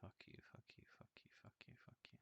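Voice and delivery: hushed, breathy whisper of curses; a muttered whispered curse at chat; whispered swearing. Real, not acted. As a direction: whisper it under your breath at the screen. Streamer whispering at chat's messages.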